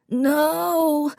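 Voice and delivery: put-on a high child's scream of no; boy screams as the dog is taken. A narrator's reading. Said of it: "Davy screamed".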